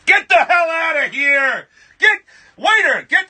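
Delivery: high, loud mock-furious yell; arsehole director shouting. Famous director throwing out a hopeful actor, mock angry shout. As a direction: play an arsehole bellowing for the waiter. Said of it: "that's what he would do because he's an arsehole".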